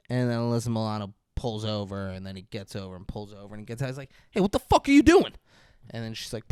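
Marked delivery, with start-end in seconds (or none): 4.3-5.3 high, loud, fast hammed-up yell; mock angry yell. Wahlberg yelling at Alyssa Milano, hammed-up angry. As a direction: bark it at her, fast and loud.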